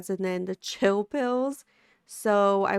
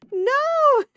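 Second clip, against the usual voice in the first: excited shout; 9 dB louder than usual. Real, not acted. Teasing about taking the picture.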